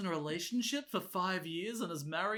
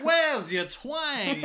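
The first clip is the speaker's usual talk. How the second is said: very slow, loud and higher; mock shout; 7 semitones higher, 8 dB louder, much slower than usual. Luke asking Kelly about her lost accent, slow mock yell. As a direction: drag it out in a stunned mock yell. Acting out Luke's shock.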